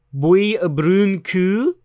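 slow, drawn-out imitation of an Old English accent; mock Old English accent. Recalling a YouTube video, he slowly imitates an Old English accent. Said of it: "the accent that Idubbbz is doing is Old English".

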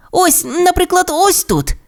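very high little voice; eager young dinosaur. Acted. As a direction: point it out like an eager kid. Timmy's character voice, pointing at map.

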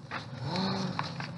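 soft surprised gasp; mock gasp. Leader pretending to spot the giant frog, soft mock gasp. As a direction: gasp as if startled.

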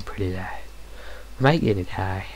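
ordinary delivery

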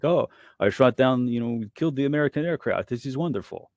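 put-on gloating Russian accent, slowed right down. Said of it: "I'm glad you enjoyed my Russian accent".

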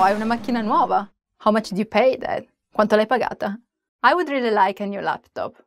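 normal talking voice

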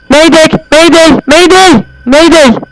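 loud, high, slow distress call; mayday call. Pretend. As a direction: shout it like a sinking pilot. Yardım çağrısı gibi bağırıyor. (yüksek sesle imdat çağrısı)

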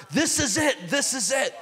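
high, thrilled cry of recognition; excited exclamation. Retold or imitated. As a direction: blurt it out like a man who found the answer. Director repeats the excited cry.

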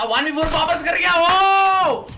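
high-pitched whoop of triumph; excited shout. Real, not acted. Gamer cheering his one-versus-four comeback, excited shout. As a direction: shout it out, rising into a big high "oh!".

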